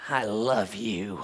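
soft, slow and sincere; heartfelt. Retold or imitated. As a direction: say it like you mean it. Sincere heartfelt contrast to robot.

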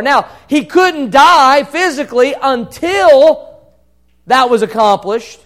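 loud, high-pitched sermon delivery; impassioned preacher at peak volume; full-force preaching. Real, not acted. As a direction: drive it home to the congregation at full voice.

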